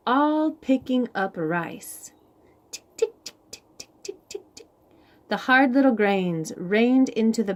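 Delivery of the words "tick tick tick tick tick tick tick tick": soft clicked ticks; ticking sounds. Acted. Rice grains falling into the basket, softly ticked.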